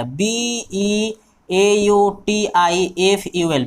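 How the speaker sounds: very slow letter by letter; teacher spelling beautiful. Shown as a demonstration. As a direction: drag out each letter for the class.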